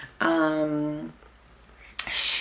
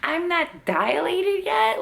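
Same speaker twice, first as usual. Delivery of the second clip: much higher, incredulous retold protest; replaying her own stunned complaint to the nurse; mother in labor disbelieving she's not dilated; disbelief; 13 semitones higher than usual. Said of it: "I'm like".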